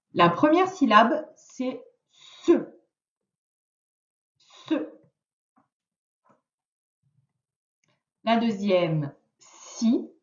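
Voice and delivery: syllabes détachées pour la dictée; dictée lente. Shown as a demonstration. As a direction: dicte chaque syllabe posément. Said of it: "je vais te dicter trois petites syllabes". (voix de dictée, lente et nette)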